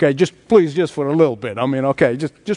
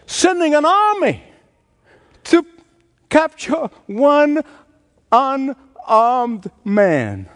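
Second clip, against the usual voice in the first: higher, slowing to a crawl on unarmed man; mocking emphasis; 7 semitones higher, slower than usual. Shown as a demonstration. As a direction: stretch out one unarmed man in disbelief. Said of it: "Just think of the foolishness".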